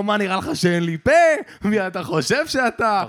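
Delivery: woman angrily scolding her defender; indignant retort. Retold or imitated. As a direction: put him in his place, outraged. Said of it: "אמרה לו".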